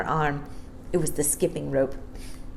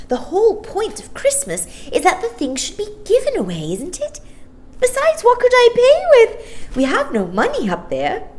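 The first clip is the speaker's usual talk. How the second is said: Maya; 7 dB louder than usual. Acted. Maya's voice in the story.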